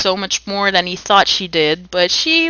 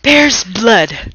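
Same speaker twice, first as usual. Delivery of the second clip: sullen but insistent; 3 semitones higher, 5 dB louder than usual. A narrator's reading. Gulick answers the group, sullen but insistent. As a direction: mutter it stubbornly, not giving in. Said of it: "sullen but insistent".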